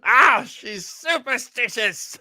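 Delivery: ordinary delivery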